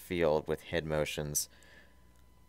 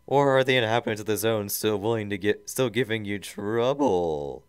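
loud, higher mad-scientist voice; villain voice; 4 semitones higher, 6 dB louder than usual. Acted. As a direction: sneer like a cartoon tyrant. Robotnik voiced on screen.

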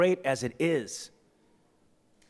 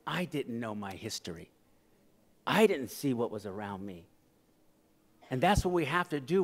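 ordinary delivery

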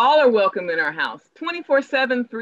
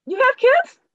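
surprised question; 6 dB louder than usual. Acted. Reacts to Taryn moving here.

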